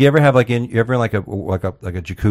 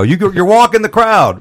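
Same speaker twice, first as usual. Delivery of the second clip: higher, louder excited owner; club owner; 10 semitones higher, 5 dB louder than usual. Retold or imitated. Club owner congratulating comic on his set, excited. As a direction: burst in like a thrilled club owner. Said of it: "The club owner's gonna come over and go".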